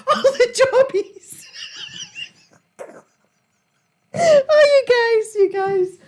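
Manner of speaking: high, slow, crying with laughter; laughing to tears. Real, not acted. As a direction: squeal it out while laughing.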